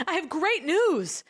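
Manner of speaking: high, slowly stretched-out mock elation; very excited. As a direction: imitate an accountant announcing wonderful news. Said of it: "why else would she be so excited saying".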